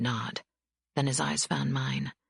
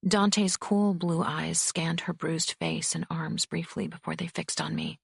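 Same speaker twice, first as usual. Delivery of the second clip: usual speaking voice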